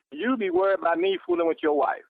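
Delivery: usual speaking voice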